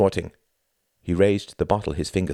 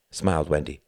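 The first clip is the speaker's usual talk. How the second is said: ordinary delivery, nothing marked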